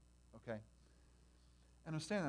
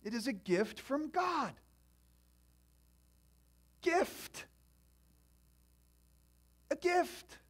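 preached emphasis; 9 semitones higher, 10 dB louder, much slower than usual. Acted.

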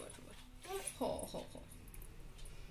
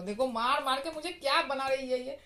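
ordinary talk

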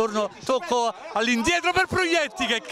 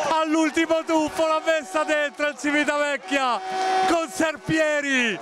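breathless italian goal commentary; 4 semitones higher than usual. Real, not acted. Third goal scored.

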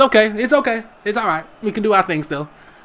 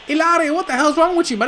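heated telling-off; 9 semitones higher than usual. Acted. Telling off a player.

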